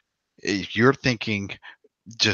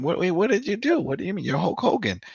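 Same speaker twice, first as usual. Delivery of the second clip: higher-pitched, mildly incredulous questioning; mock bewilderment in a raised voice; baffled kids; 10 semitones higher than usual. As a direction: imitate puzzled kids asking what happened. Voicing baffled kids questioning Hulk Hogan.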